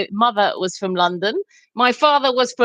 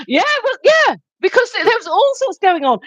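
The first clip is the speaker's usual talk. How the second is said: laughing; 11 semitones higher than usual. Real, not acted. Laughing while recalling funny moments.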